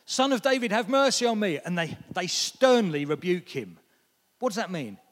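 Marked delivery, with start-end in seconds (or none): 0.1-1.6 loud, high plea calling across the crowd. Retold or imitated. Blind beggar calls out to Jesus, loud high plea. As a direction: cry out for mercy, raised high and loud. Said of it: "So he calls out".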